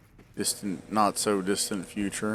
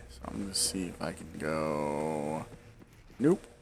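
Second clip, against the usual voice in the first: usual speaking voice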